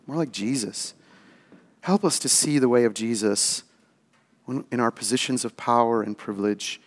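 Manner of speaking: soft, low prayer voice; closing prayer. Real, not acted. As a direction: pray humbly for the congregation.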